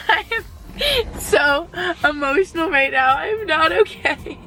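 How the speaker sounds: choked up, near sobbing, high and breaking; crying through the words, overwhelmed; speaking through real sobs; real tears. Real, not acted. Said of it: "I'm literally sobbing".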